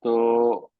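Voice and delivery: usual speaking voice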